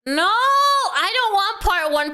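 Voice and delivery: high, slow indignant whine; complain, drawn out; mock protest. Real, not acted. Protesting a cliffhanger.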